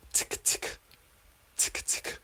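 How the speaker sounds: "tick" twice, unvoiced and soft; whispered ticks. Shown as a demonstration. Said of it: "we're gonna do it twice".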